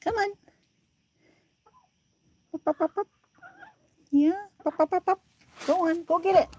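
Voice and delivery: high coaxing calls to chickens; calling the chickens. Real, not acted. Owner calling her hens up, high coaxing. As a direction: urge the hens up into the coop.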